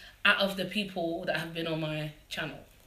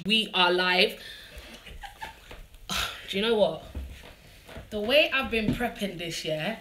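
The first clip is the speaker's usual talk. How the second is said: ordinary talk, nothing marked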